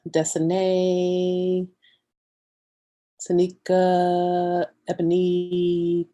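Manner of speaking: names held long on one level note; drawn-out roll call. Real, not acted. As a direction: greet each name evenly, stretching the last syllable. Greets viewers one name at a time.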